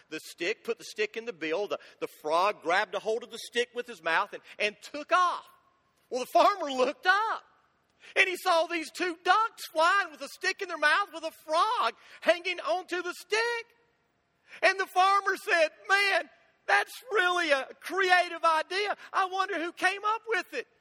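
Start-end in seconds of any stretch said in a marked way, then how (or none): 4.6-15.7 excited punchline. Acted. Joke punchline told with rising excitement. 15.9-20.7 farmer voice. Acted.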